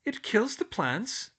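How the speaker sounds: high, slow astonished question; surprised. A narrator's reading. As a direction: gasp out the surprise. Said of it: "Hermione said in surprise".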